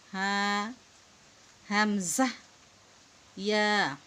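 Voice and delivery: loud, stretched Arabic letter names; drawn-out letter recitation. Shown as a demonstration. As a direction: call out each letter long and clear like a Qaida teacher. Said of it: "আমরা মোট ঊনত্রিশটি হরফ পড়লাম". (টেনে টেনে জোরে হরফ পড়া)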